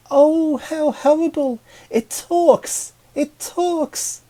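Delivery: very high, loud, whiny little flower voice; a tiny flower shrieking in disgust; whining flower voice. Acted. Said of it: "it whined in a feminine voice".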